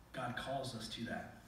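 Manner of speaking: ordinary talk, nothing marked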